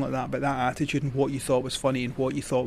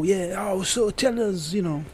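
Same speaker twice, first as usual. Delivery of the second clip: lightly imitated French accent, pitched higher; Belgian-French accent; 6 semitones higher, slower than usual. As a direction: mimic an inquisitive Francophone journalist. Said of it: "I'll stop the accent now".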